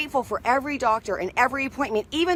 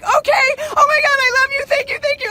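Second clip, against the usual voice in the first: much higher and louder, near tears; gushing thanks to the gate agent; overjoyed gushing; 14 semitones higher, 7 dB louder than usual. Retold or imitated. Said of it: "I was like".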